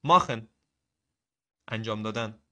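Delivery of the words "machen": ماخن with ch as a throaty kh; ماخن, ch pronounced like Persian خ; kh sound. Shown as a demonstration. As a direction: say ماخن with a clear خ in the middle. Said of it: "بعضی جا خ".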